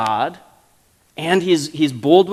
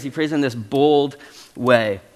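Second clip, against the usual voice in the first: normal talking voice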